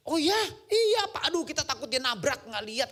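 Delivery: lightly voiced anxious usher; mock usher. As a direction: replay the usher's worry. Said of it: "ibu-ibu usher".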